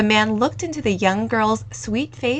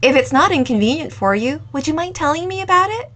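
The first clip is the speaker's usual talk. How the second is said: louder, bright-eyed curious voice rising at the end; young owner eagerly asking for his story, louder; eager young cafe owner; 2 semitones higher, 7 dB louder than usual. Acted. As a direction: coax the story out of him, eagerly.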